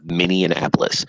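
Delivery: slow Minneapolis with the extra "an" sound; stretched Minneapolis, the old mistaken way; old wrong way. Shown as a demonstration. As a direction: Minneapolis drawn out as Mini-an-apolis. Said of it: "I used to say it like that all the time".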